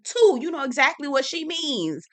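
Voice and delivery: ordinary talk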